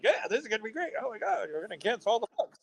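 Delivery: very high, giddy mock enthusiasm; naive excitement. His stupid younger self at the news, mock-naive glee. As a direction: gush in a silly high voice. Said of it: "I was stupid at the time".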